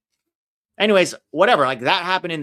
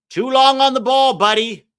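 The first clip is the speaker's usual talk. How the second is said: much higher, much louder call-out; yelling at a player; 11 semitones higher, 10 dB louder than usual. Real, not acted. Viewer yells at a player who held the ball, loud. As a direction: shout at the screen.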